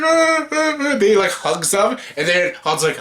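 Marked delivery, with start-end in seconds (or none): 0.0-0.8 high Wookiee moans, hammed up; mock Wookiee greeting cries; Chewie noises. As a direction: croon like a happy Wookiee.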